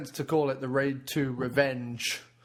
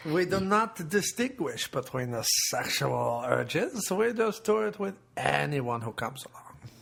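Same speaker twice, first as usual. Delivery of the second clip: put-on French accent. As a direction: draw it out in mock French. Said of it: "Does it sound French or not?".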